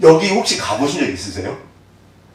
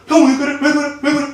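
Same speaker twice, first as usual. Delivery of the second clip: high, mock-tearful pleading to his brothers; imitating a frightened younger brother, much higher; pleading cry; 10 semitones higher than usual. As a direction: beg like a confused boy about to cry. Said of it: "막 우는 거예요".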